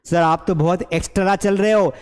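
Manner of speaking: एक्स्ट्रा broken as "eks-ta-raa"; mispronounced extra. Retold or imitated. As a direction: imitate a learner adding a vowel inside एक्स्ट्रा. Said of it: "बेटा ये एक्स्ट्रा नहीं है". (एक्स्ट्रा को "एक्सटरा" बोलना)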